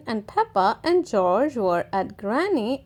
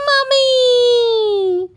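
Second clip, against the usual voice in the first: high, louder little shout; child shout; 10 semitones higher, 5 dB louder than usual. Acted. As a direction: call out Mummy like a toddler. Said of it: "shouted George".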